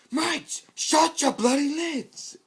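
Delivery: very high, very loud, slow bellow; furious yell. Acted. Sniper finally yelling to stop the fight, bellowing. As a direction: roar at them to shut up. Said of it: "never heard Sniper yell before".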